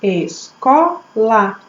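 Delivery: "Escola" slowly split as Es... co... la; three separate beats on "Escola"; teacher counting mouth openings in "Escola"; syllable by syllable. Shown as a demonstration. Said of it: "quantas vezes eu abro a boca".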